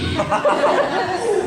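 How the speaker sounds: high hammed-up evil cackle; mock triumphant cackle; villain laugh.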